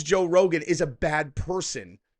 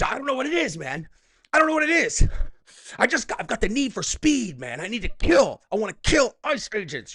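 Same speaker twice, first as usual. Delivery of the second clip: mock tough-guy voice; 7 semitones higher, 4 dB louder than usual. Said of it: "when she talks to her friends".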